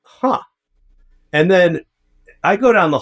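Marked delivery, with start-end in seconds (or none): 0.0-0.4 suspicious huh. Retold or imitated. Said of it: "So I'm like".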